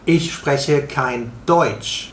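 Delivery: Ich spreche kein Deutsch spelled out slowly, word by word; teacher slowly models the German phrase for learners, slow and careful; slow teaching demo. Shown as a demonstration. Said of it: "What you would say".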